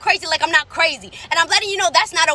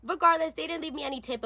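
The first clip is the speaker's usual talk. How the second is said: usual speaking voice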